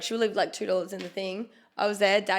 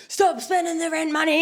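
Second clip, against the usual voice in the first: high, raised mock scolding; mock yelling; 8 semitones higher, 4 dB louder than usual. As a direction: yell it at the missus in jest. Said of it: "we yell at his missus".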